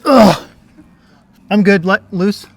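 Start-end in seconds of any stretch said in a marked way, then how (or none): 0.0-0.5 strained grunt of effort. Real, not acted. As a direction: grunt while pulling up. Straining on the wall.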